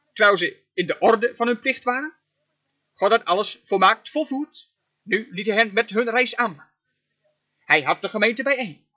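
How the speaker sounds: ordinary talk, nothing marked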